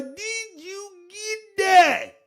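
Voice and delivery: very high, slow wail; high-pitched drawn-out mimicry; Cornelius. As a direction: stretch every word in a high put-on voice. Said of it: "In my Cornelius voice".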